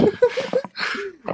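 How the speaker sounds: high, loud laugh; helpless high-pitched laughter. Real, not acted. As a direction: burst out laughing. Laughs during awkward interview.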